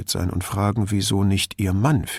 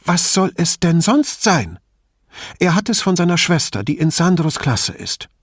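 higher-voiced anxious mother, pressing on; a worried mother confiding in the detective, higher; 8 semitones higher, 4 dB louder than usual. A narrator's reading. Mother answers, surprised.

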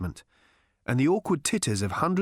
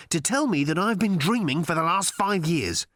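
high, insistent plea; agitated plea; 6 semitones higher than usual. A narrator's reading. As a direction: press the demand, voice pitched up. Arthur pleads for reassurance in agitation.

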